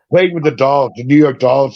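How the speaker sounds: usual speaking voice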